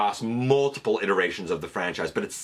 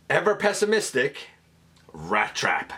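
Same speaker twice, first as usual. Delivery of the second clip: normal talking voice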